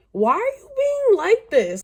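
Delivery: high, loud disbelief; outraged. Real, not acted. Reader yelling at the book character. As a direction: throw the question out in outrage.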